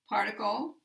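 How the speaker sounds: normal talking voice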